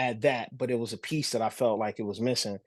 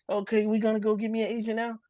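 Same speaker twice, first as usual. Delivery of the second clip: put-on higher-pitched kid's question; imitated eager child; 9 semitones higher than usual. Child asking Dad for an agent, imitated eager kid voice. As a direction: pipe up like a hopeful kid pestering Dad.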